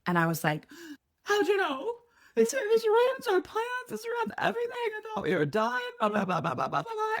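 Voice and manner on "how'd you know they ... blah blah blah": high-pitched hammed-up wailing rant; mock hysterical crying, dissolving into blah-blah babble; crying and angry. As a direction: imitate yourself wailing in a squeaky panic. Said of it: "crying and angry".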